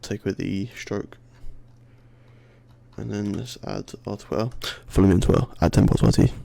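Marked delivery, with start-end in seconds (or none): 4.9-6.3 loud announcer plug. Acted. Plugs his Twitter account.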